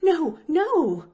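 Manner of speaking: high, loud hasty refusal; alarmed. Acted. Nancy stops Pollyanna telling, high and alarmed. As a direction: cut the offer off in alarm.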